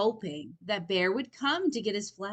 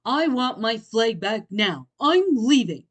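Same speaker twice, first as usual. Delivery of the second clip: louder, rising anger; angry outburst; 3 semitones higher, 11 dB louder than usual. Acted. Bear demanding his flag back, furious. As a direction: storm off as you speak.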